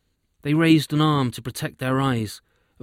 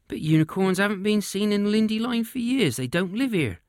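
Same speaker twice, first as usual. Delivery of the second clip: put-on higher old witch voice, puzzled; 9 semitones higher than usual. Witch doubting the unicorn story, in a higher witch voice.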